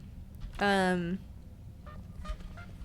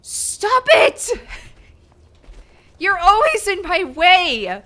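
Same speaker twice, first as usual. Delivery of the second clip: high, loud frustrated shout; angry yelling; 10 semitones higher, 12 dB louder, slower than usual. Real, not acted. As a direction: scold the character furiously. Yelling at in-game character.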